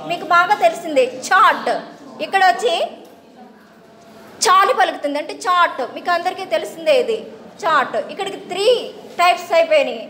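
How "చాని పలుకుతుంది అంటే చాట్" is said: ordinary talk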